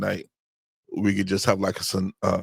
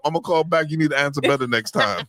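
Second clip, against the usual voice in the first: much higher, hammed-up threat to hang up; mock scolding; 8 semitones higher, 3 dB louder than usual. Husband acts out hanging up on wife, mock stern. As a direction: play it as a fake stern husband. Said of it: "I'm like".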